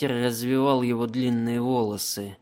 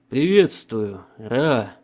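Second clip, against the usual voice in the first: loud greeting; 6 dB louder, much slower than usual. Acted. Emperor greets loudly.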